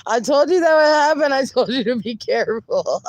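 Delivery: loud, high, words through a laugh; laughing hard. Real, not acted. Teasing a viewer while laughing loudly. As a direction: crack up while you scold. Chat teasing, streamer in stitches.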